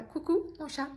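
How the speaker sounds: high, cooing hello to the cat; cat greeting. Real, not acted. Greeting her cat as it walks past, cooing and high. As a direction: coo at the passing cat.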